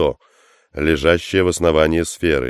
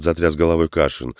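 usual speaking voice

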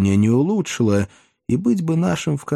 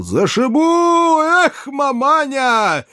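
high, slow, full-throated battle yell; 4 dB louder, much slower than usual. Acted. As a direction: bellow it as you leap. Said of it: "в голос заорал".

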